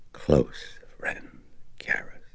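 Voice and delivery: soft breathy demon taunt; menacing whisper. Acted.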